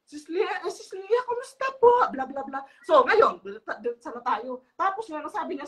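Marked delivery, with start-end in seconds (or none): 0.1-1.9 high, syrupy fake-polite greeting, rising louder at the end; sugary sweet Sis Lynn. Mocking Sis Lynn sweet-talking Lilia over speakerphone, high and syrupy. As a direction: lay on the fake sweetness, climbing higher. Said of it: "parang ano, ba, uh, yeah, mabait, mabait".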